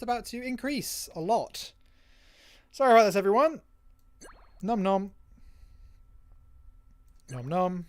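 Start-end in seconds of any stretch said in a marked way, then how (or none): 2.7-3.6 loud mock apology. Apologizing to crew before cannibalism. 4.6-5.1 loud playful chomping noises; nom nom chomping. Acted. Crew eating each other. 7.3-7.9 loud playful chomping noises; nom nom chomping. Acted. Crew eating each other.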